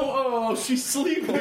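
high, tender coo over the dog; laughing coo about the sleeping bulldog. Real, not acted.

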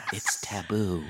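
hushed half-whisper. Shown as a demonstration. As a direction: say it softly like a forbidden secret. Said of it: "what are you gonna whisper".

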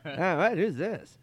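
high puzzled impression; Norm Macdonald. Norm not knowing who texted, high puzzled impression. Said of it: "he's done that to me every time".

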